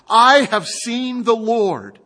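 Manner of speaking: loud, slow, high proclamation; Mary telling the disciples with joy; excited announcing. A narrator's reading. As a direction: announce it with wonder.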